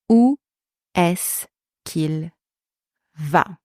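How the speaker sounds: broken into chunks. Shown as a demonstration. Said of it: "I'm gonna give you example first".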